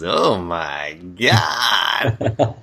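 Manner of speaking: very slow, stretched-out imitation of awe; mock swooning. Woman seeing his massive pecs, hammed-up drawn-out swoon. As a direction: drag out every word in hammed-up admiration. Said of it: "she's like, he's, you know, massive pecs".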